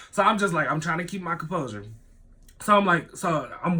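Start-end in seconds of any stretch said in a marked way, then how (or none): none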